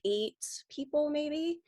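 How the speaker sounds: ordinary talk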